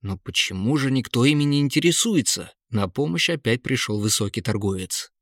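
ordinary delivery, nothing marked

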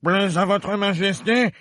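high, slow, loud pleading; very humble gardener. Acted. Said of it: "d'une voix très humble".

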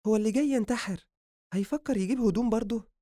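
high female voice, teasing question; high-pitched female character; needling woman. Acted.

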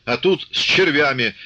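high, drawn-out angry calls; indignant outcries. A narrator's reading. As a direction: take up the angry cry from the crowd. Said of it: "подхватили ближние".

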